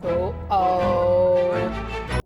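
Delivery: normal talking voice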